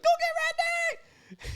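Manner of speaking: very high, loud and slow mocking scream. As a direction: shriek the threat back at him, hammed-up. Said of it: "he's just, like, really screaming".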